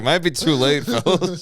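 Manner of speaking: high, laughing mock senator; resigned senator. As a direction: shrug it off like a doomed senator. Senators realize Caesar took throne.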